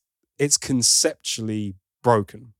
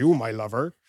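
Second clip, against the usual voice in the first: put-on West Country accent. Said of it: "bit West Country".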